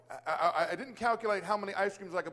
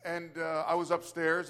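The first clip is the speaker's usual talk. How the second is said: usual speaking voice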